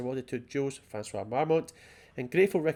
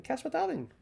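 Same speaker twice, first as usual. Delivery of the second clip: high pitched; 11 semitones higher than usual. Pretend. Reading a signature with flourish.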